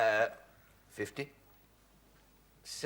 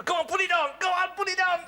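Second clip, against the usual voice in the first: high-pitched, excited egging on; gleeful urging; 7 semitones higher, faster than usual. Acted. Host urges his partner to put the present on, gleeful urging. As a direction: goad him gleefully, pitched right up.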